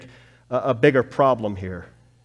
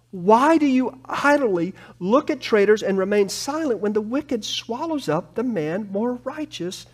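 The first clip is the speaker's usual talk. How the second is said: anguished prayer; 8 semitones higher than usual. Acted. Habakkuk crying back to God, anguished and pleading. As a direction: cry out in protest to God, pleading. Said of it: "Habakkuk crying back to God".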